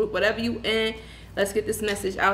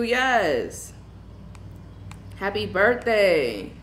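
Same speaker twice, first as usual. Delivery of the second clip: ordinary delivery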